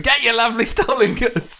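high cockney market-stall call; cockney street hawker. Retold or imitated. Hawker selling stolen goods on corner, cockney call.

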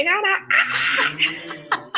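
slow, giggly and delighted; laughing excitedly. Real, not acted. Telling a friend about the booked trip, laughing. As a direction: laugh through the words, thrilled. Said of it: "I'm so excited".

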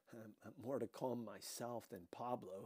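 ordinary delivery, nothing marked

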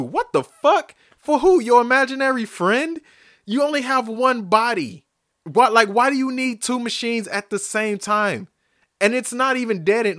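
high, loud exasperated yelling; furious rant at a selfish gym-goer; angry rant. Real, not acted. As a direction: rage at the gym hog in disbelief. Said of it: "Rant over".

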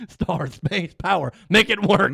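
loud, high demanding boss; angry boss. Retold or imitated. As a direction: bark orders like a tyrant boss. Mock author demanding.

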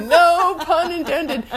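laughing, slow and high. Real, not acted. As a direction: chuckle the words out. Laughing at own pun.

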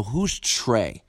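usual speaking voice

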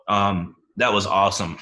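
ordinary talk, nothing marked

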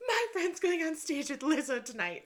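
high, retold outburst; excited blurting. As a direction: blurt out a friend's big news. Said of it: "My friend blurts it out".

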